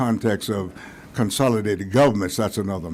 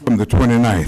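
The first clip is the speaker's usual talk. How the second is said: panting after running up; short of breath, pushing words out; 10 dB louder than usual. Real, not acted. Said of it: "I'm out of breath running up".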